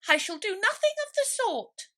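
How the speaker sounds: very high and loud; indignant mouse. A narrator's reading. Mouse refusing Alice's help, high indignant squeak. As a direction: huff it out offended. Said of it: "said the mouse".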